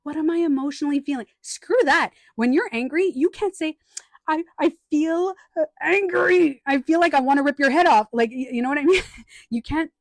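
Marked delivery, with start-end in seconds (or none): 0.0-1.3 higher, fast, dismissive parody; mock version of the question to avoid; mocking the wrong question. Shown as a demonstration. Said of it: "not like". 4.3-8.1 much higher and louder, hammed-up fury; playful mock anger; mock angry confession. Acting an angry person.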